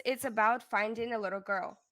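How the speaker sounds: usual speaking voice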